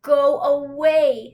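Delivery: sleepy Toad shooing Frog away; grumpy dismissal. Acted. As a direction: push him off grumpily.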